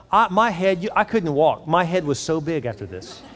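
ordinary talk

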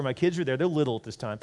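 ordinary talk, nothing marked

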